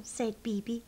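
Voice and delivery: plain reading voice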